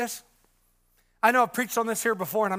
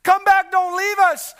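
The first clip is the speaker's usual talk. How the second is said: much higher and louder, pleading; retold desperate cry; 8 semitones higher, 7 dB louder, slower than usual. Brother [name] waking from the dream, pleading shout. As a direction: beg him not to leave, crying out. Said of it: "In the dream I woke up crying".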